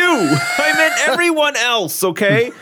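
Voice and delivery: high laughing protest, slowing down. Real, not acted. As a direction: laugh it out, pleading innocence. Laughing apology to listening wife.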